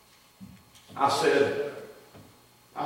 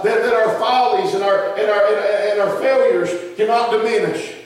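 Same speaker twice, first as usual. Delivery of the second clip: louder, rising preaching toward a closing shout; building preaching; 7 semitones higher, 7 dB louder than usual. Acted. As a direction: drive the message home to the church. Sermon builds to full preaching.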